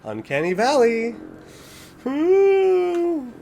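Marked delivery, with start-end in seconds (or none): 2.1-3.3 high drawn-out ooh; high creeped-out ooh. Real, not acted. Hearing the skin looked fake yet real, a high ooh. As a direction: let the creepiness hit you.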